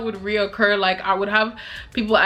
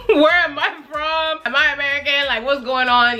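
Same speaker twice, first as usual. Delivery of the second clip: mock questioning; 5 semitones higher than usual. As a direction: mimic nosy questioners, pitched up. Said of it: "they start asking me questions like".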